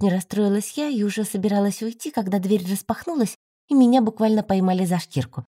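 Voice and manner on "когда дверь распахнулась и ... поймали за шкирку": ordinary delivery, nothing marked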